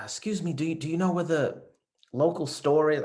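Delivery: lightly voiced tourist asking. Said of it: "she says to me".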